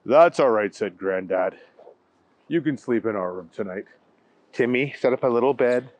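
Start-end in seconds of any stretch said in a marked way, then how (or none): 0.0-3.9 gentle granddad. Acted. Granddad comforting Timmy.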